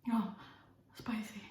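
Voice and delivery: usual speaking voice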